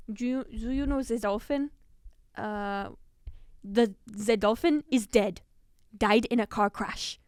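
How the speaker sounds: slow deadpan fake German accent; deadpan fake German interviewer. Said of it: "he's like".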